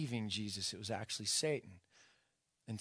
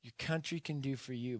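normal talking voice

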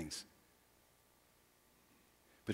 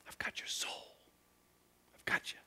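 soft unvoiced whisper; intimate whisper; 20 dB quieter than usual. Jesus reassuring his disciples in a whisper. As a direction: breathe a promise close to the ear.

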